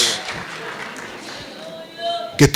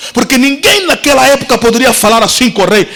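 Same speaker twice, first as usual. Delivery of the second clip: ordinary talk, nothing marked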